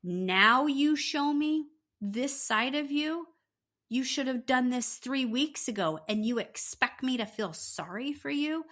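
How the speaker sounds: indignant imitation, each question thrown at him; retold scornful confrontation. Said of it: "looks him dead ass in the face and goes".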